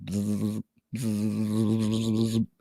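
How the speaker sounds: low buzzing hum through the lips; bee buzzing. Retold or imitated. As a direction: buzz like a swarm of bees. Imitating buzzing bees.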